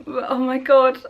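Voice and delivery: usual speaking voice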